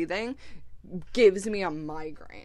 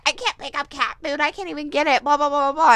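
mocking whine; 7 semitones higher, 8 dB louder than usual. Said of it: "she bitched at me".

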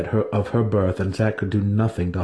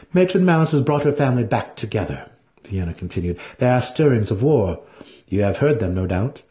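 proud priestess; 3 semitones higher than usual. Acted. Vienna's voice in the story.

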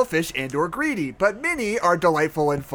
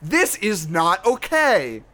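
mock outrage; 2 semitones higher, much slower than usual. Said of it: "most popular parent review".

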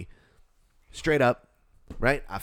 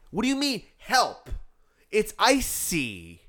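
high, drawn-out disbelief; exasperated scolding; 3 semitones higher, slower than usual. Retold or imitated. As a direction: act out scolding, stretching the last words. Acts out scolding the man.

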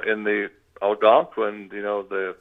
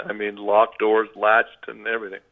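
ordinary talk, nothing marked

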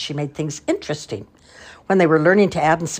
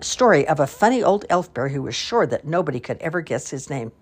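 plain reading voice

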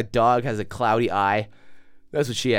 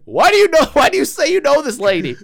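much higher and louder, scolding disbelief; angry yell; 12 semitones higher, 10 dB louder than usual. Retold or imitated. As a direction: blast him for a dumb move. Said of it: "the cab guy starts giving me shit".

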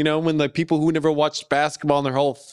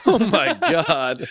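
high, drawn-out laughing exclamation; scandalized and cracking up, stretching it out; laughing disbelief; 5 semitones higher, much slower than usual. Real, not acted. Laughing at an outrageous joke.

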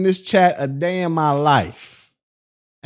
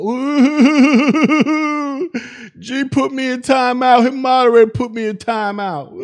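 mocking whiny chatter; 3 semitones higher, slower than usual. Mocking whiny chatter complaining about timeout.

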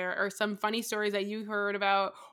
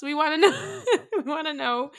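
high, loud plea breaking into laughter; laughing appeal; 5 semitones higher, 5 dB louder than usual. Real, not acted. Host urging listeners to share stories, laughing. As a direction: urge them while cracking up.

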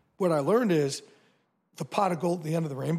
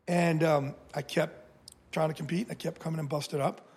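ordinary talk

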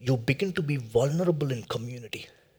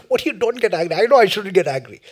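mock-indignant comeback, pitched up; exasperated retort; 5 semitones higher, 7 dB louder, faster than usual. Man answering friends who say don't get angry, mock exasperation. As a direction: fire back at useless advice, playing it up. Acted retort to unhelpful advice.

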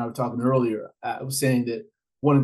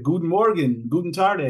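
language tape greeting; 5 semitones higher than usual. Retold or imitated. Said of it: "You might hear".

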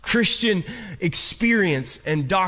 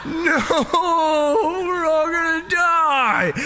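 panicked; 10 semitones higher, 3 dB louder than usual. Retold or imitated. Panicked teen, crying out.

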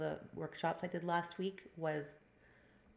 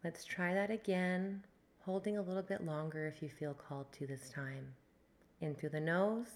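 guided meditation. Acted. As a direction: ease them into the breath. Leads a breathwork meditation.

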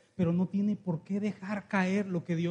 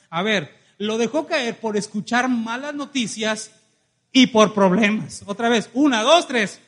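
slow, loud call for the crowd to echo; preacher leading a response; 3 semitones higher, 8 dB louder, slower than usual. Acted. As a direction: count them in and shout it together. Preacher leads congregation response.

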